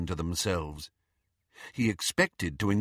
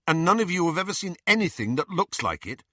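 higher, quicker questioning voice; questioning student; 8 semitones higher, faster than usual. A narrator's reading. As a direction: ask the group quickly, pitched up. Narrator voices questioner in dialogue.

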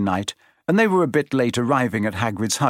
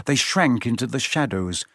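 usual speaking voice

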